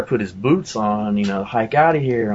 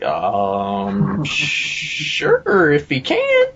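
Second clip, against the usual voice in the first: hesitant um, then pitched way up; doubtful reply; 4 semitones lower, much slower than usual. Retold or imitated. Friend answers the healing offer before a small crowd, doubtful.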